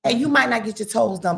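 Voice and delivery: normal talking voice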